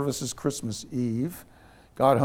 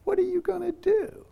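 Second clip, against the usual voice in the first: much higher, grinning question from the driver; smiling driver's question. Retold or imitated. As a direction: ask it lightly with a big grin, pitched way up. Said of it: "he smiles and goes".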